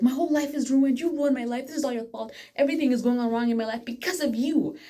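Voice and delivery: mock angry outburst. The girl blaming him for her ruined life, mock angry. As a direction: voice her blaming tirade with heat.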